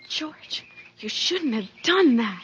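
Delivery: frightened whisper. Acted. Wife whispering to George, scared. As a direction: hush him in fear.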